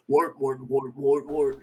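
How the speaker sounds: loud guttural alien chant; elite alien call. Shown as a demonstration. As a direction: bark it like a Halo elite. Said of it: "Let me do the wart".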